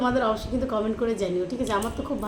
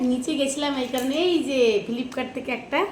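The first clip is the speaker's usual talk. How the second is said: usual speaking voice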